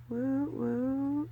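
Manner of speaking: small pleased woo-woo; little celebratory whoop. Real, not acted. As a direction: cheer lightly on two rising notes. Small cheer at twenty dollars.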